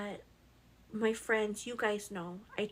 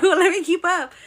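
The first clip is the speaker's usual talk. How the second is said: flustered; 8 semitones higher, 15 dB louder than usual. Retold or imitated.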